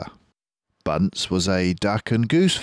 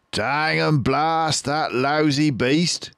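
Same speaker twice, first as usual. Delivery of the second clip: higher, slow cursing farmer; Boggis crying out; 8 semitones higher, slower than usual. Acted. Farmer cursing the fox, higher slow outburst. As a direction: spit out the curse. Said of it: "cried Boggis".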